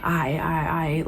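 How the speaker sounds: low-key, softly repeated acceptance; quiet, giving in without a fight; resigned mutter. Retold or imitated. Caught shoplifter to store staff, resigned and quiet.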